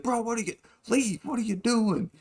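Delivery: imitating a panicked friend; mock frantic shooing. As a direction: push him away without making a scene. Kai shooing Los away.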